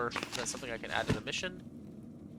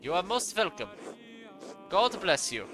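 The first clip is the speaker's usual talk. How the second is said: put-on courteous German-accented farewell; 3 semitones higher than usual. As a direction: sign off politely in a German accent. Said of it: "I gave him a German accent".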